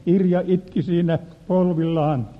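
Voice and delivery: ordinary talk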